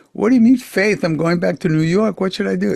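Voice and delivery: high, loud pleading; frantic younger self arguing; own frantic protest. Retold or imitated. Arguing with himself about going to America, high and pleading. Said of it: "And then I say".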